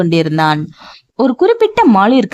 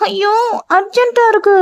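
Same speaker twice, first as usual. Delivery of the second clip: put-on childlike urgent whine; 12 semitones higher than usual. A narrator's reading.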